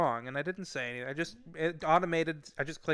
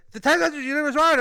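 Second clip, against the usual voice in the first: high, rapid-fire angry yelling; imitating a caller screaming at him; screaming support guy; 13 semitones higher, 11 dB louder, faster than usual. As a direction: scream the accusation in one garbled rush. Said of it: "guy from DoorDash screamed at me".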